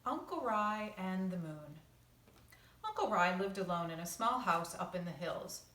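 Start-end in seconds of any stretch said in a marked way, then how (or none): none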